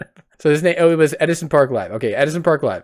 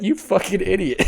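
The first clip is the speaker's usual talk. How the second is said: imitating Edison; 6 semitones higher than usual. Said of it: "Edison just is like".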